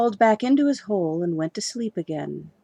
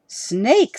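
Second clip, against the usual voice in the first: surprised; 5 semitones higher, 6 dB louder than usual. Acted. Husband surprised.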